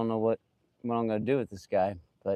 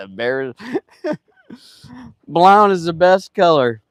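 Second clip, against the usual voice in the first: laughing, then loud and high; real laughter bursting into a loud cheer; amused exclamation; 8 semitones higher, 10 dB louder than usual. Real, not acted. Laughing at Bear's color joke.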